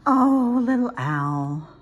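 long, loud motherly "oh" falling away; sighing. A narrator's reading. Mommy Owl answers her child's latest worry, sighing. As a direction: sigh it out as an indulgent mother. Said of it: "sighed Mommy".

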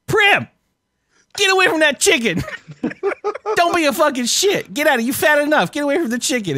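high, loud retold yell at the cat. Owner yelling at cat Prim over chicken, high loud yell. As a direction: bark it at the cat like she's stealing dinner. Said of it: "I was yelling at my cat".

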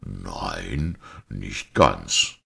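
low, pensive priest answer; musing. A narrator's reading. Priest half contradicting the sceptic, musing. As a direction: pitch it down, still pondering. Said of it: "noch immer sinnend".

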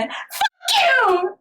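mock girl's curse. The English translation of the girl's line, high mock curse. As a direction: fling it at him like the translated girl. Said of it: "영어에서는 어떻게 번역돼 있냐면은".

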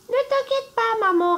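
put-on little goat kids. Little goats reassure their mother, in a high childlike voice. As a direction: squeak it like tiny goats reassuring mum. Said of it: "répondirent les chevrons".

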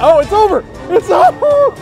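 ordinary delivery

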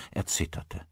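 usual speaking voice

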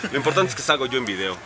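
ordinary talk

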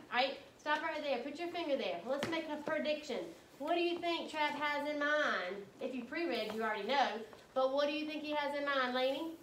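ordinary delivery

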